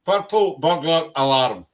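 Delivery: "Purple burglar alarm." with rolled Scottish r's; tongue twister in Scottish accent. Shown as a demonstration. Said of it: "I wanna hear you say".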